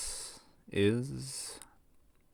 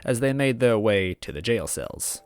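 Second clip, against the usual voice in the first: steady first-person storytelling read; calm narrator voice; story narration; 6 dB louder than usual. A narrator's reading.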